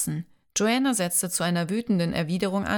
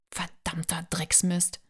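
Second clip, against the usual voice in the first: hissed curse under the breath; slow whispered swearing; 5 dB quieter, slower than usual. Acted. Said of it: "fluchte Joanna".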